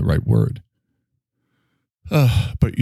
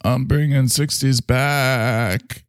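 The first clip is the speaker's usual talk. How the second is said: slow awestruck voice; 6 semitones higher, much slower than usual. Retold or imitated. Kids praising hip dad.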